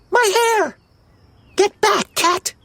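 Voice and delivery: very high, squealed outrage; shrieking. A narrator's reading. Smurfette fends off the cat, shrieking. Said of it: "Smurfette shrieked".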